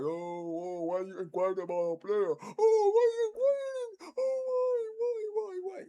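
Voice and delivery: high, wailing fake sob; mock crying. Streamer mimicking whiny rival fans upset about a transfer inquiry, mock crying. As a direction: whine it like a bawling baby, making fun of them. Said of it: "Sitting here crying, like".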